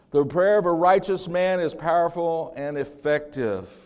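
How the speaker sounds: booming scripture. Acted. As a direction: proclaim it to the whole church. Quoting scripture in the sermon.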